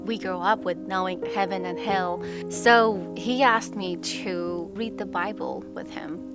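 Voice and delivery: ordinary talk, nothing marked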